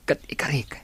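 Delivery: whisper. Acted. Edgar confessing love to Shalani.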